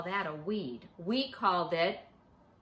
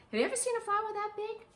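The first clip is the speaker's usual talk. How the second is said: eager question; 13 semitones higher, 3 dB louder, faster than usual. Real, not acted. Asking children in wonder.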